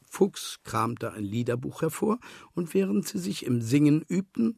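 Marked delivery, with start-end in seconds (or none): none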